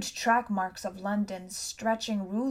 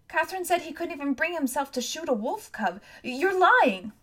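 upset denial; 7 semitones higher than usual. A narrator's reading. Boy defending Valentine to girl, upset. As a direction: protest in a high voice, then burst out.

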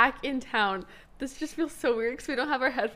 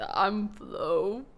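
mocking low, soft cool-guy voice; imitated fake voice; 5 semitones lower, 5 dB quieter than usual. Teasing a guest over his introduction, imitating his fake voice. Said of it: "That's your fake voice".

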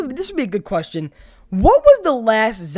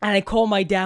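ordinary talk

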